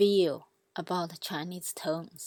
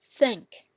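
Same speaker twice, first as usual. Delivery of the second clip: "think" as a loud first-tone drill; high tone; 3 semitones higher, 7 dB louder than usual. Shown as a demonstration. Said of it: "keep repeating them".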